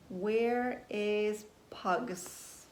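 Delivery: slow, drawn-out "Where is Pugs?"; slow wondering question. Acted. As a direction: pause on each word, wondering.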